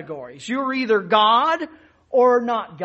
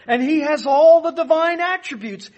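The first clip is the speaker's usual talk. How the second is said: ordinary talk, nothing marked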